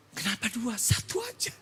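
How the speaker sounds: soft, high-pitched wife voice; wife objecting. Retold or imitated. Wife questions husband's drink purchase, high soft objection. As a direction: quietly pipe up in a high voice.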